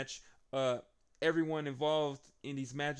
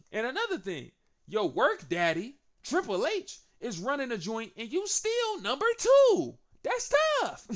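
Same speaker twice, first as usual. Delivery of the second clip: much higher and louder, building into a crowing yell; hyped taunt; 10 semitones higher, 8 dB louder than usual. Retold or imitated. Roman roasting Seth, rising into a loud crow. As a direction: roast him and shout the punchline. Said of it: "And Roman says".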